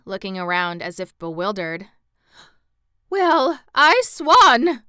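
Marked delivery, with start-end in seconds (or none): 3.1-4.8 slow, much higher and louder, gurgled in fright; hoarse frightened. A narrator's reading. Aunt Em startled by the court, hoarse frightened gurgle. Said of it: "gurgled Aunt Em in a hoarse, frightened voice".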